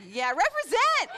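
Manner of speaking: high, loud shout-out; hyped call of represent; excited cheer. Real, not acted. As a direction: cheer for your group. Cheering for middle kids.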